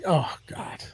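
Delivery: whispered exasperated "oh God". Real, not acted. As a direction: breathe it out under your breath. Exasperated aside.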